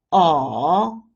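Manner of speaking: "oh" dipping down, then up; falling-rising tone. Shown as a demonstration. Teacher leads repeat drill, dipping oh. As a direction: sink then lift a long "oh". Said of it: "The third tone is the falling rising tone".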